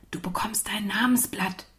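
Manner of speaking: soft unvoiced whisper to a friend. A narrator's reading. Isa whispers to shy Amina, hushed whisper. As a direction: whisper it so the teacher won't hear. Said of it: "flüsterte sie".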